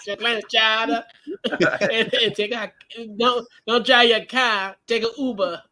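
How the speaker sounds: giggly Boston imitation; Boston accent. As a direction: play up a Boston accent, dropping the R's. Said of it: "do the Boston accent".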